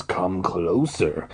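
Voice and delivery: put-on slow, low, friendly old man. A narrator's reading. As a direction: coax them in, low and slow. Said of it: "in a friendly voice".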